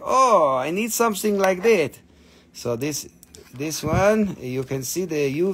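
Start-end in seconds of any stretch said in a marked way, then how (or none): 0.0-2.0 mock buyer. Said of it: "you will be like".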